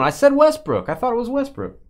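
high, insistent triumph; gloating. Real, not acted. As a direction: insist you were right all along. Gloating after winning a guess.

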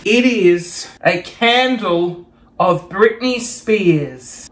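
slow, deliberate reveal. Real, not acted. Vlogger unveiling a [name] candle, slow reveal. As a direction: draw the words out for effect.

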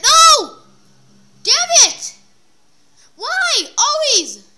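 high, loud frustrated cries; frustrated yells. Real, not acted. Gamer crashing again yells in frustration. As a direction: yell at the game in frustration.

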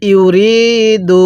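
ইউরিদু intoned very slowly with a long held vowel; teacher demonstrating ইউরিদু in recitation style; slow tajweed recitation. Shown as a demonstration. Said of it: "কিভাবে পড়ব?".

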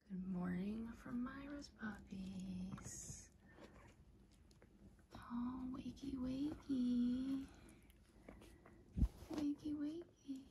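soft, slow, whispery coaxing; gentle wake-up. Real, not acted. Owner waking the puppies with wakey wakey, gentle and hushed. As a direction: rouse them very gently.

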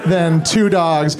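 ordinary delivery

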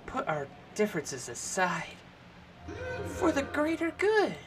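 put-on high, distant old wizard voice; high character voice. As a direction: speak as a lofty old wizard.

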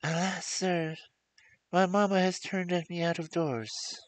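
put-on high, plaintive young girl; weeping girl. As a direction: tell the prince of her sorrow. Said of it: "why she cried".

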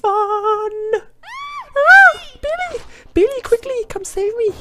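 very high, helpless cry for Billy; shrill falsetto plea for help; damsel in distress. Pretend. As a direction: squeal for rescue like a damsel. Voicing damsel character calling Billy.

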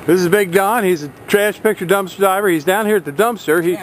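ordinary talk

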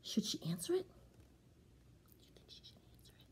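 put-on whispered question to the kids; asking the children in a hush. A narrator's reading. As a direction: whisper it like a secret.

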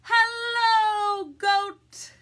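big, high, drawn-out duck hello; calling out a greeting. Acted. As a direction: lead children in a loud hello. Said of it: "Do it with me".

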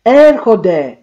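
loud, high alarmed cry; panting warning that they are coming; frightened loud alert; breathless warning. A narrator's reading. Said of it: "λαχανιτά και γυναικεία φωνή".

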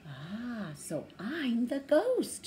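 slow, dawning realization; realizing thought. A narrator's reading. Penduli realizes, thinking, that she is the ghost. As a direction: think it as it dawns on you. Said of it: "she thought".

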